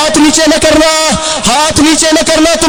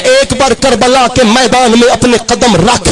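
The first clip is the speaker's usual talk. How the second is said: fiery preaching; 4 semitones lower than usual. Acted. Preacher voicing Husain's challenge to Yazid, fiery. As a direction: proclaim it like a battle cry.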